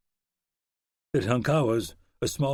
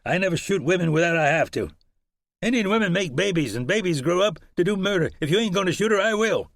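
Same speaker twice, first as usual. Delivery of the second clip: higher and louder hard-edged frontiersman; rough horseman; 9 semitones higher, 5 dB louder than usual. Acted. Horseman threatening to shoot the fleeing woman, hard. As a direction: voice a callous gunman, hard and cold. Horseman voiced, harsh talk.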